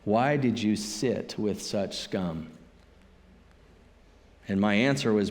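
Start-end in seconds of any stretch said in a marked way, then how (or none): 0.0-2.5 lower, softer and slow, a sniff of contempt; disdainful question. Retold or imitated. Self-righteous friend sneers at the pastor, softer and slow. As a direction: let the disdain drip out slowly. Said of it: "his equivalent of".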